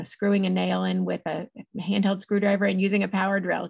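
normal talking voice

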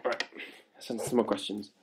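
normal talking voice

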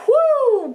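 a sharp, high, exasperated whoo; high frustrated whoo. Real, not acted. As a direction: let out a fed-up high yelp. Exasperated groan at the series.

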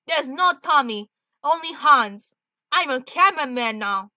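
put-on loud, emphatic, would-be Russian accent; bad Russian accent. Hans insisting Tommy is gone, bad Russian accent. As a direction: declare it in a clumsy Russian accent. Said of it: "I'm trying to do his accent".

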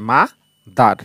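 "মা", a clear pause, then "দার"; broken into syllables. Shown as a demonstration. Teacher showing learners two syllables, "মা" ... "দার" split apart. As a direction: say "মা" and "দার" as two separate beats. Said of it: "দুইটা syllable ব্যঙ্গে উচ্চারণ করতে হচ্ছে".

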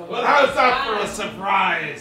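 ordinary talk, nothing marked

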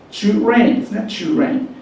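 trainを「チュレイン」と高めに発音; 「チュレイン」の頭をチュで始める; チュに変わるT. Shown as a demonstration. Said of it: "Tの音を超えちゃってます". (英語式の「チュレイン」)

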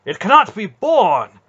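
high, loud outraged cry. A narrator's reading. Knight crying out against the cruelty, high and loud. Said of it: "Manderallen cried".

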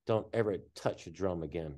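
low, quiet threat; menacing warning. Retold or imitated. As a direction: drop the voice into a stern warning. Drummer warns the newcomer.

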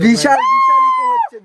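high thrilled shout; excited shout. Real, not acted. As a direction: cry out in delight. Thrilled by the big echo.

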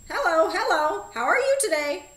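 high, loud squawking parrot; a talking parrot greeting a customer at the door, high and loud. A narrator's reading. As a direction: chirp it out like a cheerful parrot. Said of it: "Presto the parrot asked".

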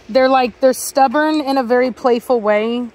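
normal talking voice

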